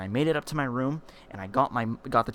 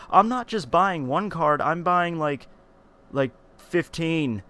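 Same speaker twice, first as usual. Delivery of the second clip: higher, drawn-out mock justification; slow, raised kid voice; mock kid boast; 6 semitones higher, 4 dB louder, slower than usual. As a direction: play the kid inflating his lie. Mimics his kid self bragging.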